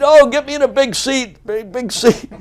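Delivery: high, loud hammed-up plea; whiny fake invalid. Bobby fakes injury to get moved to first class, high whiny plea. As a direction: moan it like a faker begging. Bobby demands a big seat.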